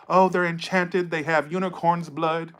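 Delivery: mock a flattering reader, drawing it out; mocking tarot readers. Imitating readers who flatter clients, mocking gush. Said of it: "like a lot of these tarot readers like to do".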